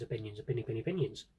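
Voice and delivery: ordinary delivery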